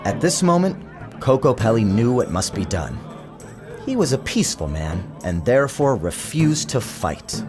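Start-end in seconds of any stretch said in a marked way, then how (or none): none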